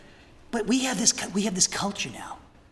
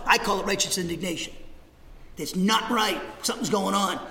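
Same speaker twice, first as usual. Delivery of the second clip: ordinary talk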